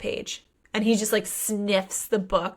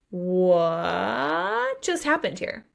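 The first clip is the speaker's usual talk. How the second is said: long rising whaaat, then the rest; hammed-up, drawn-out astonishment; mock shock; much slower than usual. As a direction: stretch the first word in rising disbelief. Acting shocked reader.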